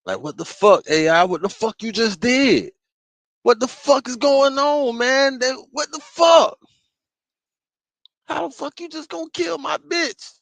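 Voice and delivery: escalating furious shout; outraged yelling. Pretend. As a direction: rage at the machine that killed your girl. Imagined outrage at AI killing someone.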